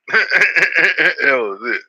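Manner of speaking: words through real laughter; laughing. Real, not acted. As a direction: laugh it out, slowly. Laughing at the four-word answer.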